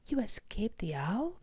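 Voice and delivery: high-pitched, amazed question; storybook young bat exclaiming in amazement; astonished cry. A narrator's reading. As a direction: cry it out in high, breathy disbelief. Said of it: "cried Stella Luna".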